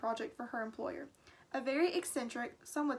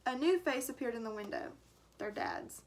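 ordinary delivery

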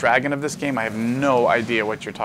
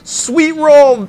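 much higher, loud victory yell; triumphant shout; 12 dB louder than usual. Real, not acted. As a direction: yell it like a battle cry. Battle cry on winning play.

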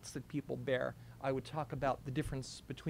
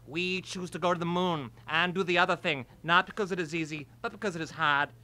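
louder, stately Kennedy speech; Kennedy impression; 3 semitones higher, 6 dB louder than usual. Boy performs a loud Kennedy moon speech for Rachel. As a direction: orate like JFK at the podium.

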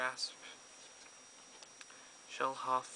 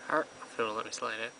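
ordinary delivery

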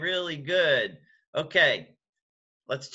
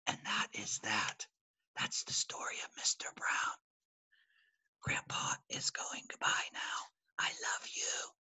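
soft loving whisper; whispered goodbye; 4 semitones lower, 12 dB quieter than usual. Real, not acted. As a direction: whisper a tender goodnight. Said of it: "a whisper".

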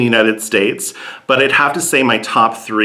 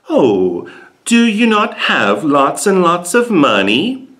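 mock spoiled rich kid; 8 semitones higher, slower than usual. Imitating spoiled Norwegians.

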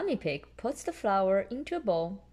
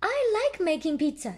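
higher, louder little piglet; Peppa voice; 10 semitones higher, 5 dB louder than usual. A narrator's reading. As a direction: say it happily as a little pig girl. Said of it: "Peppa says".

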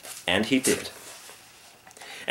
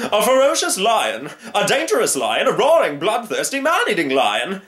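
high, loud, hammed-up panic; blustering storybook hunter; alarmed hunter crying out; 10 semitones higher, 4 dB louder than usual. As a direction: build the list of dangers dramatically. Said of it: "cried the hunter".

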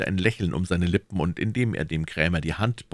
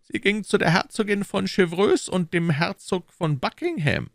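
higher, pressing questions as the Cardinal; Cardinal questioning; 14 semitones higher than usual. Acted. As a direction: interrogate his agent coolly. (der Kardinal, verhörend)

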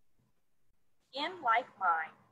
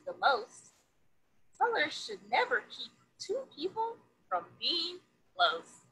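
lively picture-book read-aloud; reading to children; 5 dB louder than usual. A narrator's reading. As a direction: read it to a classroom of kids, bright and sing-song.

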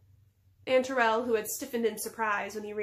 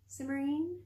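higher, rising doubtful question; 5 semitones higher, 3 dB quieter than usual. A narrator's reading. Alienora unsure of the stranger, doubtful. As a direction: query the name uncertainly. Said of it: "Alienora's tone was doubtful".

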